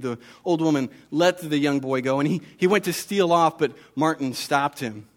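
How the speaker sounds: ordinary delivery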